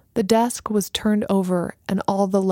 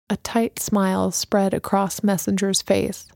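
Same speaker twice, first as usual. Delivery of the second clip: plain reading voice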